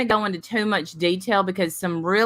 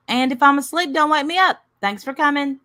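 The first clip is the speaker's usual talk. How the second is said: higher, louder house-rules voice; mock hostess notice; 6 semitones higher, 4 dB louder, faster than usual. Hostess reading house rules to a guest, mock notice. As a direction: recite the guest rules, pitched up.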